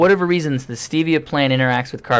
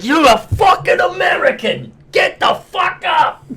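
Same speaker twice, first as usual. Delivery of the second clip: mock shout, much higher and slow; imitating the ranting comedian; 4 dB louder, slower than usual. Friend imitating Joey Diaz's rant, shouting slowly. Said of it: "And he's like".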